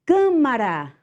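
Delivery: Câmara said high and falling, closed câ; teacher's model word. Shown as a demonstration. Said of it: "o can tem um acento circunflexo".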